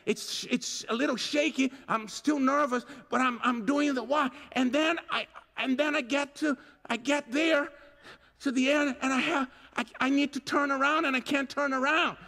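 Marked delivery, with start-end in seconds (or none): none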